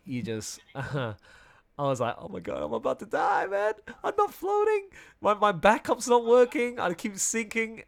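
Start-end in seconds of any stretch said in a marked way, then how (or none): 2.2-7.8 panicked. Retold or imitated. Retelling near drowning panic.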